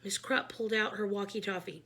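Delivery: ordinary delivery, nothing marked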